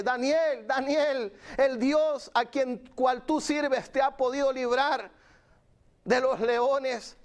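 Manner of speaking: high, drawn-out call into the pit. Acted. King Darius calls Daniel at the lions' pit, calling out. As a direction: call down slowly to someone far below. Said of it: "hablándole ahí al pozo, diciéndole".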